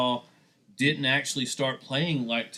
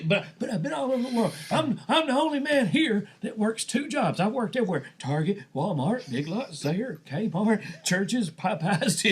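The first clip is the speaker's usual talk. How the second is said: put-on high bragging old-timer; old man voice; 8 semitones higher than usual. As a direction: boast like a proud old man. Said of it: "did this old man voice".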